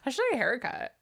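mock Valley girl with swooping tune. Riffing on episode titles to irk co-host, mock Valley girl. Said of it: "[name] Valley girl voice".

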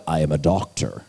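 low mock-dignified title voice; mock important. Preacher voicing someone who holds a doctor's title, mock important. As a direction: announce it deep down, with put-on importance.

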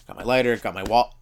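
normal talking voice

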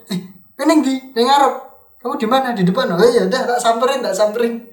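high-pitched pretend call; acted phone chat. Student faking a call to leave the pricey cafe, mock phone voice.

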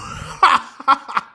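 high-pitched laughing burst; cracking up. Real, not acted. Unable to finish reading the meme, high laughing fit. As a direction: break into real laughter.

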